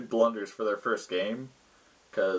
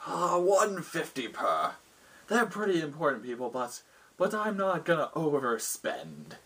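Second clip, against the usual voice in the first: haggling lord; 5 semitones higher than usual. Acted. Lord sets the price.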